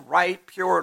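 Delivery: ordinary talk, nothing marked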